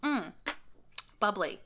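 just sipped a drink; talking right after a sip; while sipping a drink. Real, not acted. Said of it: "Hold on, I have to take a sip".

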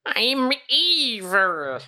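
high, slow cartoon villain gloat; megalomaniac rant. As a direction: gloat like the eighties Skeletor. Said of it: "that old eighties Skeletor".

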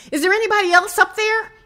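high, loud, rapid shout. A narrator's reading. Climber looking for another rescuer above, shouting up. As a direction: call up urgently with a rising question. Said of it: "the man shouted up again".

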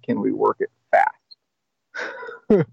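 slow, stretched question rising at the end; drawn-out hopeful ask. Retold or imitated. Asking his sponsor to rush the steps, slow and drawn out. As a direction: stretch "Can we work it fast?" out slowly, hoping.

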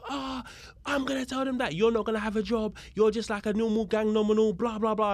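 high-pitched mock outrage; retold angry rant. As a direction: imitate an officer flying off the handle. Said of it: "it fucking sent him right off".

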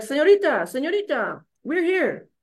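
high, lightly voiced driver calling her awake; taxi driver voice. Taxi driver waking his dazed passenger, light high calling. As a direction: call out señorita like a cabbie. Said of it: "the taxi guy's like".

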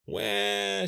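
loud, high, held wail; acted bawling cry on one long high note; child's wail. A narrator's reading. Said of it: "she wailed".

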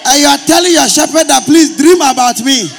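high, shouted sermon rebuke; loud, high-pitched preacher's scolding; full-force preaching. Real, not acted. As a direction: shout it to the congregation, stretching key words.

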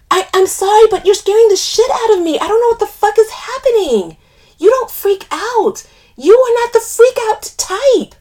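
loud, high, frantic pleading; panicked. A narrator's reading. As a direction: argue back, scared out of your wits. Panicked friend arguing back, loud and high.

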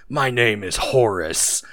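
a slow, lightly voiced tough-kid movie line; slow, deadpan delivery of a kid hero's big moment; Horace's tough line. Retelling Horace's Monster Squad line to a friend, slow and mock-tough. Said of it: "he cocks the shotgun and goes".

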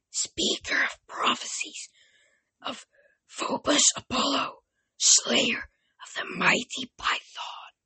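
eerie whispered hiss, slow; hissing oracle. A narrator's reading. Ancient oracle speaking in minds, ghostly whisper. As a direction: breathe it like a dead spirit. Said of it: "her voice hissed inside my head".

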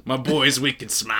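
put-on slow, echoed Boston accent; drawn-out Boston imitation. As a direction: savour the line in mock Boston. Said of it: "says it in such a Boston accent".

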